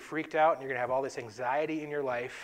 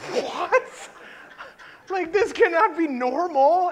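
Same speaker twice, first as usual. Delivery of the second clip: much higher and louder, slower disbelief; retold panic; 12 semitones higher, 9 dB louder, slower than usual. Teen passenger after the alarm, mock panicked disbelief, higher and louder. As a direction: sound like a scared teen who can't believe it. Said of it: "And I was like".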